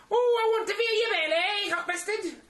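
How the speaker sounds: put-on very high, breathy girlish. [name] cooing at the pregnant friend, very high mock.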